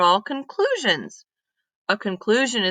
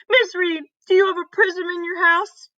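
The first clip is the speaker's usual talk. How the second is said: put-on higher, eager child's blurt; eager question; 10 semitones higher than usual. Annie blurts her question to Miss Reed, higher and eager. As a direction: blurt it out, unable to wait.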